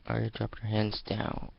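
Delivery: ordinary delivery, nothing marked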